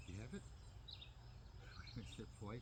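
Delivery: ordinary talk, nothing marked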